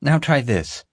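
excited high call, falling at the end; excited exclamation. A narrator's reading. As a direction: pitch it up, keen to try the next trick. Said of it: "I exclaimed".

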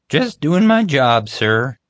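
high, loud, slow and self-important; proud guard. Acted. Guard dismissed by his chief, proudly. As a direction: pitch it up, basking in the thanks.

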